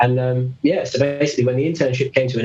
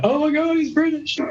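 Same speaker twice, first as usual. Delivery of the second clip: high-pitched mock squeal of amazement; hammed-up, high excited shriek without extra volume; excited crowd squeal. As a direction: imitate gushing fans, pitched way up. Crowd reacting to his accent.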